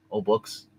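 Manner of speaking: ordinary talk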